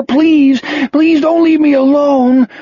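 a sobbing plea, voice way up; weeping plea. Acted. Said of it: "he wept".

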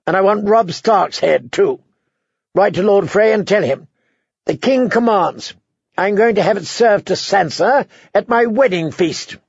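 put-on a spoiled young tyrant insisting; petulant boy king. A narrator's reading. Joffrey demands Robb's head.

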